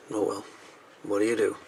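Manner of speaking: ordinary delivery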